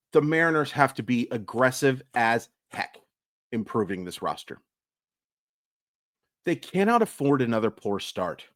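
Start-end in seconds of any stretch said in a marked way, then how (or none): none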